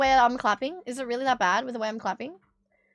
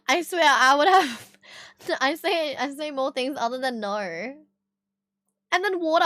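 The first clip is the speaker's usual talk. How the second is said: ordinary delivery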